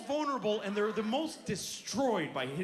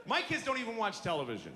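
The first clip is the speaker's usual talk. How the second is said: ordinary talk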